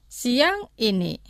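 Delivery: slow, careful Siang ini; slow for repeating. Shown as a demonstration. Teacher says Siang ini slowly for students to repeat. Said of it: "Los pronunciaré lentamente".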